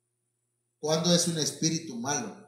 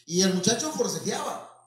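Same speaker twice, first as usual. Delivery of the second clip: ordinary delivery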